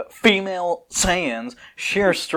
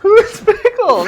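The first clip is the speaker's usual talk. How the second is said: laughing; 8 dB louder, slower than usual. Real, not acted. Laughing at the silly name.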